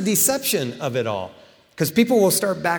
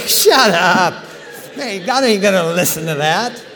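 mock retort; 3 semitones higher, 5 dB louder than usual. Reply mocking the greedy prayer.

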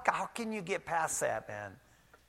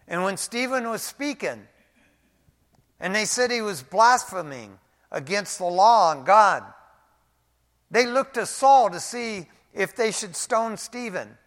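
rising preaching; 6 semitones higher, 9 dB louder than usual. Acted. Preaching builds to stoning scene.

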